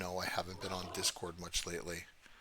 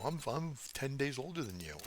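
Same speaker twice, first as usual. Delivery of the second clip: lightly mocking higher-pitched wife voice; mimicking wife; 6 semitones higher than usual. Husband quoting his wife's birthday boast, lightly mocking. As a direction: imitate a teasing boast, pitched up a little.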